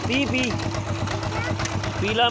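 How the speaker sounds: high coaxing call of ਪੀਲਾ; calling an animal. Real, not acted.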